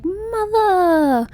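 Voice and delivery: high, drawn-out singsong greeting; daughter greeting mother. Retold or imitated. Daughter greets her mother at the docks, sugary and high. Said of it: "and she's like".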